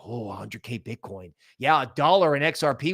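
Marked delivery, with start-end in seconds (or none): 0.0-1.3 mock awe. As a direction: lower and much softer, mocking the crowd's awe. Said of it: "everybody's like".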